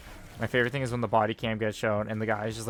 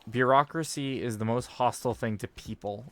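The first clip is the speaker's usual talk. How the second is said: ordinary talk, nothing marked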